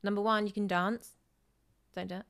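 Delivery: ordinary delivery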